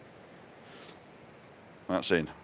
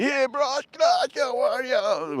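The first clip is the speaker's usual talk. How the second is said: put-on high, drawn-out Jamaican-accented greeting; exaggerated Jamaican accent imitating schoolmates; mates' Jamaican accent; 4 dB louder, much slower than usual. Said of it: "Jamaican parents".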